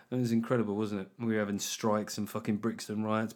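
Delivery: ordinary talk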